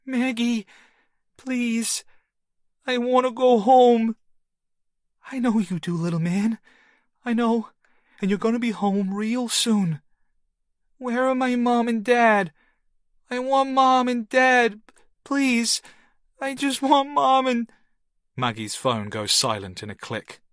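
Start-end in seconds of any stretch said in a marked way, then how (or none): 0.0-4.2 put-on high, small tearful child; a little boy weeping for home. A narrator's reading. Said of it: "little boy weep". 5.3-10.0 comforting. A narrator's reading. Woman comforting boy. 11.0-17.7 put-on high, small tearful child; a little boy weeping for home. A narrator's reading. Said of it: "little boy weep".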